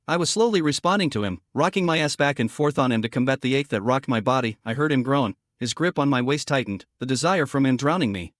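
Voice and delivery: ordinary delivery, nothing marked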